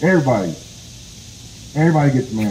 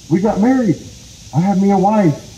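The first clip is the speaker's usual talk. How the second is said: proud announcement; 7 semitones higher than usual. Acted. Rabbit boasts to possum of wedding.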